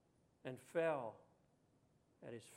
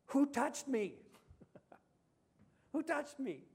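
higher, louder searching call; Jesus asking; 9 semitones higher, 4 dB louder, slower than usual. Retold or imitated. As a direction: call out to the crowd, wanting an answer. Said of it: "We see that Jesus said".

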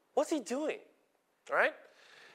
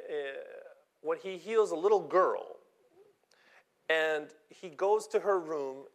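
ordinary delivery

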